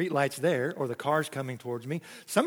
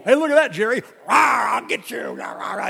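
louder, higher mock-growling snake; playful snake monster; 5 semitones higher, 5 dB louder than usual. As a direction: ham up the shadow snake attacking Jerry. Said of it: "I was a great snake on the wall".

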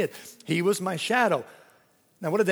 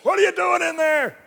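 much higher, louder shout up the stairs; parent yelling; 13 semitones higher, 7 dB louder than usual. Retold or imitated. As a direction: bellow like an annoyed parent at night. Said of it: "heard my mom and dad".

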